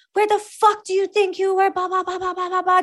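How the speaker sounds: high-pitched mock chewing-out; retold angry yell. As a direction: bark it like a furious staff officer. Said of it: "my staff ripped me apart".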